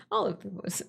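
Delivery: ordinary talk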